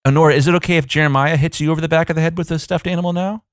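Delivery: a lightly hammed-up eerie parent; creepy. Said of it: "if you wanna get really creepy".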